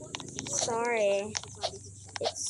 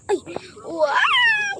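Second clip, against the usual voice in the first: very high, loud squealed wow; amazed wow; 8 dB louder than usual. Real, not acted. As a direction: shriek with delight. Amazed at free item.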